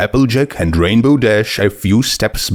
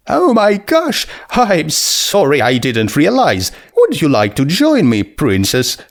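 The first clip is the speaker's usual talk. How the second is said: a much higher, bubbly voice, quickly sorry; high, eager skeleton girl; 7 semitones higher than usual. Acted. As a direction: gush with sudden friendly excitement. Skeleton girl apologizes and invites.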